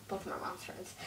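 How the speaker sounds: soft, whispered muddled take; whispered wrong version; whispered slip. Pretend. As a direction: mutter the mix-up under your breath. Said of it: "both of my friends' moms said yes".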